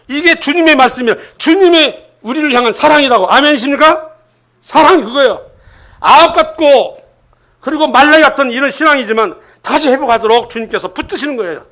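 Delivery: high, emphatic preaching; forceful preaching. Real, not acted. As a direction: proclaim it to the congregation with force.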